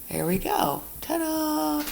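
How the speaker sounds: high sing-song ta-da; playful ta-da. Real, not acted. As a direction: announce it like a little magic trick. Data filled down.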